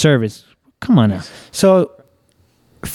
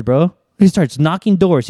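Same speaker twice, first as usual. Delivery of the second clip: ordinary delivery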